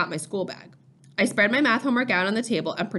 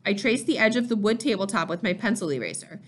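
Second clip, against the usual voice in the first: ordinary delivery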